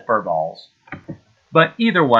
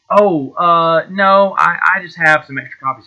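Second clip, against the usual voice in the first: flustered; 5 semitones higher, 7 dB louder than usual. Retold or imitated. Caught out about pirated copies.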